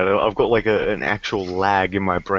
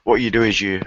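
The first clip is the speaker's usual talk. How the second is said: ordinary talk, nothing marked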